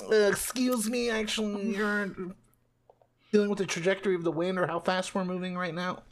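put-on high nerd voice, politely condescending; nerdy know-it-all. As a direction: correct them like a fussy know-it-all.

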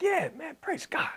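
soft, high, casual murmur; offhand praise. Acted. Listener hearing a prophecy the first time, offhand soft praise. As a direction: toss it off lightly, barely voiced. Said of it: "you hear it the first time".

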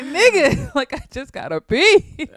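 high and loud, laughing through it; giggly high-pitched annoyance; laughing, exasperated. Retold or imitated. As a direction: laugh out a mock complaint. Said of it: "I'm like".

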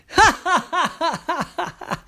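high, repeated staccato ha-ha; forced joke laugh. Acted. Koala Kenny laughs at his own joke, high staccato laugh. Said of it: "said the koala".

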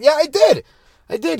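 put-on high, loud insistent little kid; argumentative toddler. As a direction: dig in and argue back like a stubborn two-year-old. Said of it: "very argumentative".

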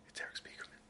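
hushed, starstruck whisper of a name; awed whisper. Retold or imitated. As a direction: whisper it like you just spotted your hero. Awed recognition of famous designer.

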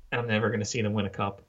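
ordinary talk

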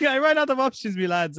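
put-on giggling through a lazy Irish accent. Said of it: "most low effort Irish accent".

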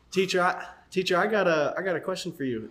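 put-on cheeky kid calling out to his teacher; little kid voice.

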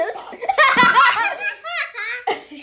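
high, loud burst of laughter. Real, not acted. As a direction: crack up loudly. Watching someone eat a gross bean.

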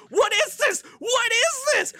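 very high yelled panic; freaking out. Retold or imitated. Friend imitating buddy freaking out, high panicked yell. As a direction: shriek it in horror. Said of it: "he's freaking out the entire movie".

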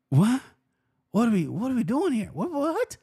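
high, incredulous what-are-we-doing; hammed-up disbelief at the sellout; mock outrage. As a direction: play it up as shocked disbelief. Mock outrage at gloves selling.

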